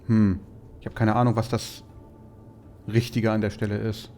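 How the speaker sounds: usual speaking voice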